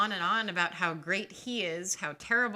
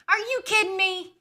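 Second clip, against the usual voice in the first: much higher and louder; scandalized, pitched-up cry; incredulous outrage; 12 semitones higher, 8 dB louder than usual. Real, not acted. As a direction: shriek it in disbelief. Reacting to the doctor's cheek.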